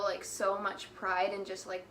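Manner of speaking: usual speaking voice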